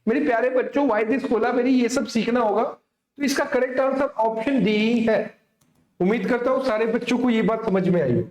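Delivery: ordinary talk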